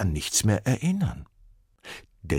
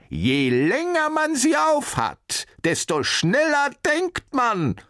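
high, slow, loud calling out; professor calling after; 5 dB louder, slower than usual. A narrator's reading. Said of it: "rief der Professor ihm noch hinterher".

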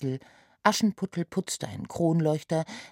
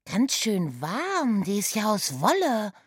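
put-on chatty girl voice; 6 semitones higher, 3 dB louder than usual. A narrator's reading. Oda wears the cap.

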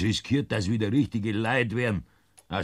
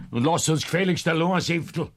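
angry dismissal; 9 semitones higher, 5 dB louder than usual. Acted. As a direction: raise the voice, higher and louder, and order him out. Sends the intruder away.

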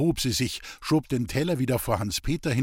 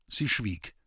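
ordinary delivery, nothing marked

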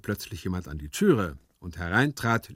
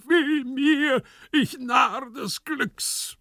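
loud, high, drawn-out tragic wail; anguished stage shout, stretched at the end; desperate cry; 4 dB louder, much slower than usual. Acted. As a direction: cry out in theatrical despair. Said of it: "rannte im Zimmer umher und schrie".

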